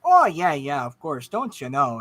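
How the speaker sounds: don't you know, Minnesota-style; folksy agreeable lilt; Midwestern accent. Acted. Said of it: "Midwestern Spark because accents".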